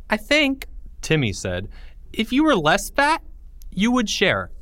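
put-on high-pitched little boy; high kid voice. Timmy character voice.

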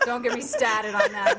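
slow, loud, laughing through it; laughing excitedly. Real, not acted. Fan on the Red Sox, laughing loud. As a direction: gush about the Red Sox while laughing.

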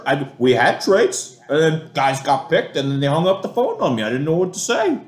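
mocking a GM's Massachusetts accent, high and flustered; Gettleman Massachusetts accent. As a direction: whine it out in a heavy Massachusetts accent. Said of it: "in his thick Massachusetts accent".

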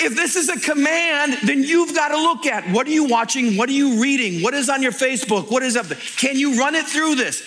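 high, driving sermon voice; preaching. Acted. Pastor challenging the congregation, driving preaching voice. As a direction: press the questions on the crowd.

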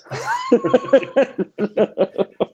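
big laugh, pitch shooting up. Real, not acted. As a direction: burst out laughing. Laughing at own jinx.

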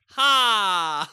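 loud forced single ha; fake laugh. Shown as a demonstration. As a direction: bark out a fake ha. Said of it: "I just fake laugh at her jokes".

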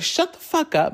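high-pitched mock outburst at mom; mimicked high, angry snap. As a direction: imitate a daughter going off, pitch jumping up. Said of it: "she starts going crazy on her mom".

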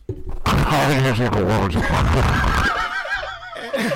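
garbled phone noise. Retold or imitated. Imitating garbled phone sound.

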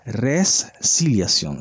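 Resiliación said slowly, each syllable clear: re-si-lia-ción; spelled-out word. Shown as a demonstration. Host teaching viewers the word, slow careful Resiliación. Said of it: "Se escribe R E S C".

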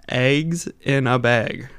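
"Eggs in a bag" drawn out slowly in a broad Wisconsin accent. Shown as a demonstration. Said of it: "showing how bad our accent is".

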